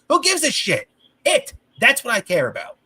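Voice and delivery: real high-pitched angry outburst; indignant, pitch leaping high; exasperated rant. Real, not acted. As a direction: burst out fed up, voice jumping up. Fed-up rant about team coddling players.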